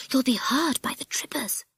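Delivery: high, urgent whispered warning; hissed. Acted. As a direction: hiss a warning under your breath. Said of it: "hissed Peggy".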